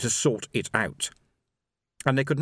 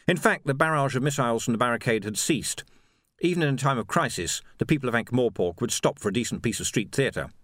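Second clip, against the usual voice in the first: ordinary delivery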